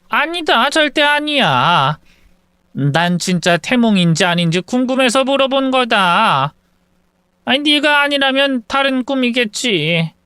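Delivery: high, hurried denial, a touch of fluster; flustered mother-in-law. Acted. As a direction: brush it off quickly, pitch jumping up. Mother-in-law denies pestering. (당황해서 높아진 시어머니 목소리)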